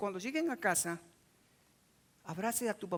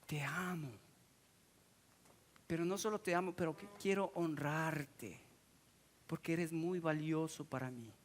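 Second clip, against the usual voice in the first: tender "I love you"; 5 semitones lower, 6 dB quieter than usual. Pretend. Child telling dad and mom "I love you", tender whisper. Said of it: "le digas".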